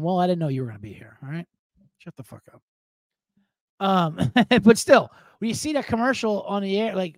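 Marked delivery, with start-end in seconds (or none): none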